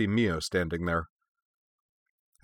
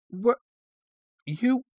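high-pitched, halting disbelief; too stunned to finish, words come out singly; sputtering. A narrator's reading. Young woman seeing her comrade's tenderness, sputtering. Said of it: "Shizuka sputtered".

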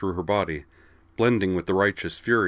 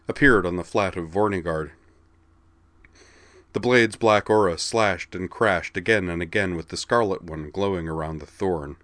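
ordinary delivery

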